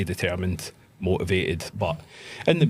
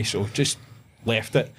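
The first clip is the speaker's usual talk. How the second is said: normal talking voice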